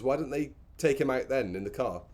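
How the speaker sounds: usual speaking voice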